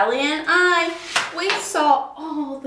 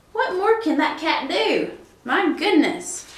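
ordinary delivery

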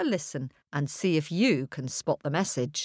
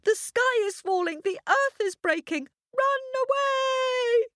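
panicked shout. A narrator's reading. Hare shouting the warning to another hare, high and frantic. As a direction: cry the warning in terror. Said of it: "and he shouted".

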